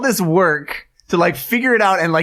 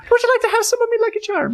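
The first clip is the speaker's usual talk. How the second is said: high, fast leprechaun voice; chirpy Lucky Charms leprechaun; leprechaun accent; faster than usual. Acted. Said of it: "I was gonna do a leprechaun thing".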